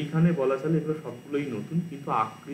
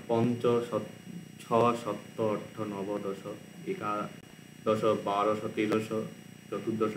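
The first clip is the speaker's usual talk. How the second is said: slow number recital; 3 dB quieter, slower than usual. Shown as a demonstration. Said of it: "reciting the number words".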